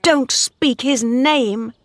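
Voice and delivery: slow, high, clipped anger; slow icy anger; angrily. A narrator's reading. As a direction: forbid the name. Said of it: "said Bathsheba angrily".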